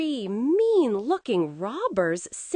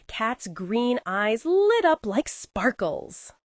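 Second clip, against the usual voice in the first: ordinary delivery, nothing marked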